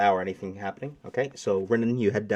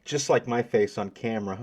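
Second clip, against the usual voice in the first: ordinary talk, nothing marked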